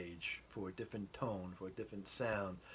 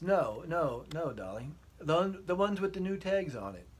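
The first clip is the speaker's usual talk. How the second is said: higher, louder English wife voice; wife insisting in a raised English lilt; English accent; 8 semitones higher, 8 dB louder than usual. Retold or imitated. Wife pointing out the tags in a raised English accent. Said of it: "I'll try to use an English accent".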